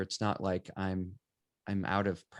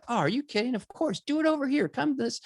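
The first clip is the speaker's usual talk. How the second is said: very high, eager voice; thrilled stranger; 4 dB louder than usual. Retold or imitated. Resident agreeing to a portrait, very high and eager. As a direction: squeal with delight at being asked.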